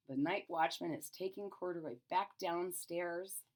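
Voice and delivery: plain reading voice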